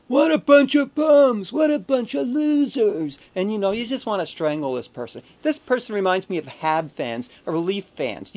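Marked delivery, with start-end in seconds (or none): 0.1-3.2 put-on slow, drawn-out drunk jeering, much higher and louder; mock the loud drunk at the pub; drunk heckler. Mimicking the drunk pub guy jeering at Canada. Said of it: "drunk and loud, and going".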